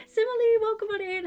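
high, loud squealed welcome; excited greeting. Real, not acted. Streamer greeting a viewer arriving in chat, squealing excitedly. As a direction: call out in delight to a new arrival.

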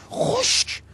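soft, barely voiced words set apart; hushed, dismissive labelling; mocking hush. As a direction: drop to a mocking near-whisper. Mocking the stiff classical setup.

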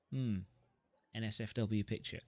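usual speaking voice

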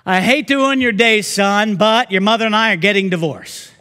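hammed-up fed-up father; fed-up old father. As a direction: blurt it out like a fed-up old man. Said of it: "he calls up his son".

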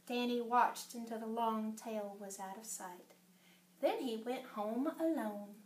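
soft, low storybook wistfulness; hushed narration. A narrator's reading. Reader telling how Danny went home alone, hushed. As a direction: read it quietly, as a lonely parting.

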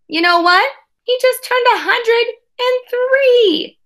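amazed reveal. Acted. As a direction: stretch it out like big news. Story reveal about great-grandfather.

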